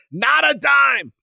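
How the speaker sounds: put-on Calhoun's New England accent, shouted and slow. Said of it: "in that New England accent".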